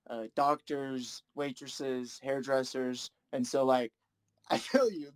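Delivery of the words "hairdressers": ordinary talk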